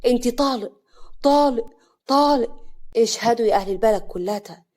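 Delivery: loud, furious public proclamation; loud anger. A narrator's reading. Husband divorcing his wife before the village, loud and angry. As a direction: hurl it out for everyone to witness. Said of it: "أحمد بصوت عالي وهو ماسكها بكل غضب".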